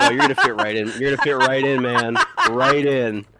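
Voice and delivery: high, loud, delighted quote; retold loud reaction. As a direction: call it out loudly over the laughter. Retold reaction over friend's laughter.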